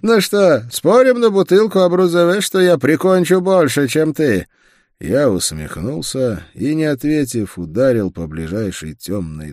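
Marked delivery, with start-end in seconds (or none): 0.0-4.5 loud, high, relishing boast; gleeful. A narrator's reading. Рансе bets his partner on who kills more, gleeful and loud. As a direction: crow with delight and challenge your friend. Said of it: "с превеликим удовольствием".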